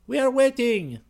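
high, loud voice of a waiting crowd; weary crowd voice. Acted. Crowd answers from the dark.

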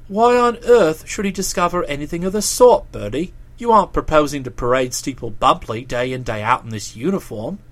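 low, protesting dialogue voice; Boko, earnestly pleading. Acted. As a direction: reason with him in a deep voice. Boko argues with Bertie.

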